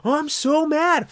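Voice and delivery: high, hammed-up whiny rage; petty rage played for laughs; mock road rage. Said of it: "You're like".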